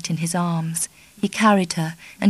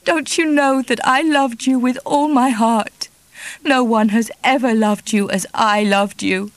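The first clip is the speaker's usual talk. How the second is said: higher, louder tearful lament; sobbed; 5 semitones higher, 4 dB louder than usual. Acted. Kitty sobbing at Charlie, high tearful lament. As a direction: break down in reproach.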